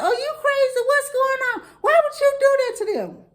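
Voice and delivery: mother scolding her daughter, high and outraged; scolding mama. Retold or imitated.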